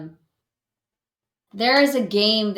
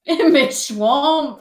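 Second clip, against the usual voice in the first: slow, louder ogre line; Shrek impression; 5 semitones higher, 6 dB louder, much slower than usual. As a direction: stretch it out like a cross ogre. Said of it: "Get out of my swamp".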